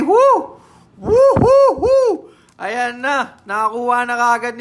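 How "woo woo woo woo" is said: much higher, louder whooping; excited whoop. Real, not acted. Breaker celebrating a twenty K hit, whooping. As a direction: whoop at the big pull. Big hit revealed on stream.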